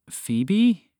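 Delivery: high, stunned question; shaken. A narrator's reading. Father recognizing his long-lost daughter, shaken. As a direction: say the name as a shaken, disbelieving question. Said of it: "clearly shaken by the word he had just heard".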